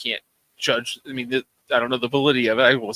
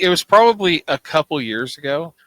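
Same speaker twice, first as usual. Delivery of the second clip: flustered; 6 semitones higher, 7 dB louder than usual. Real, not acted. Caught off guard, laughing.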